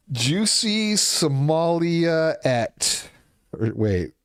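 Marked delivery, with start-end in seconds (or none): 0.1-3.1 mocking drawl. Mocking nickname for a woman.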